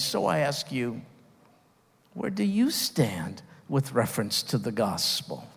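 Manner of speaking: ordinary talk, nothing marked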